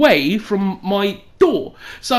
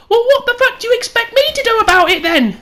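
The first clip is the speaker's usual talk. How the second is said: exasperated mum; 12 semitones higher, 4 dB louder, faster than usual. Retold or imitated. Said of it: "And then she said".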